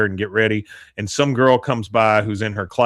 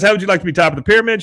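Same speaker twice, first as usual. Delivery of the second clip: fast, high, louder sales pitch; Peggy eagerly recruiting Connie, fast and higher; 8 semitones higher, 4 dB louder, much faster than usual. Retold or imitated. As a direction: rush the offer out eagerly.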